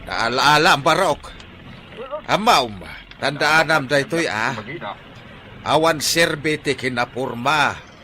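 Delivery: loud scolding moral; loud lecture. Acted. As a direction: drive the lesson home. Driver lectures loudly after fight.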